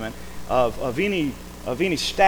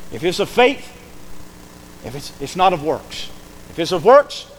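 ordinary talk, nothing marked

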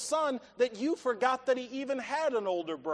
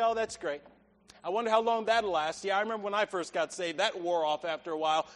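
unimpressed shrug; 7 semitones lower, 3 dB quieter, faster than usual. Retold or imitated. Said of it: "and you say".

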